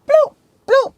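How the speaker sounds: high, quick bloop bloop like central locking; two high mock lock beeps; car lock bloops. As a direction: chirp it out like the car doors locking. Imitating car doors locking.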